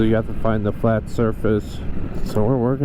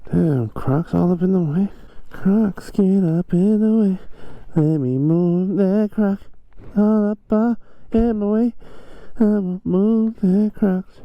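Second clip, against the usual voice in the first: excited; 9 semitones higher than usual. Real, not acted. Raised excited voice riding.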